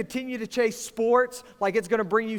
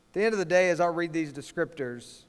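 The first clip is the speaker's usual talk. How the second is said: ordinary talk, nothing marked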